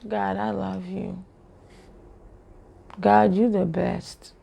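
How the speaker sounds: soft, slow, lifeless praise; dark, low energy. Shown as a demonstration. Believer praising God with dark energy, listless. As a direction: say loving words slowly with no life in them. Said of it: "is, is darkness".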